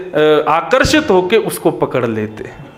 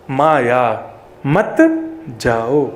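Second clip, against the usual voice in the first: "माया मत ... जाओ" spaced slowly with a pause before "जाओ"; slow split phrase; 5 semitones lower, much slower than usual. Shown as a demonstration. Preacher explains to devotees that "Maya" means "मा या", slowly splitting it. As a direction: stretch "माया मत" slowly, then drop "जाओ". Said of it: "इसका अर्थ करते हैं".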